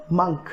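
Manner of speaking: मंक with a short "uh" vowel; correct pronunciation. Shown as a demonstration. Teacher giving learners the correct pronunciation, मंक with "uh". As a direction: say मंक to rhyme with "trunk". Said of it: "correct pronunciation है".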